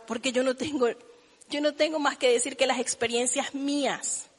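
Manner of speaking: usual speaking voice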